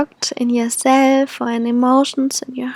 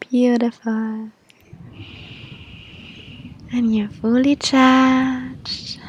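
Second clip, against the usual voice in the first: airy breathy mystical healer. Acted. Leads a guided meditation.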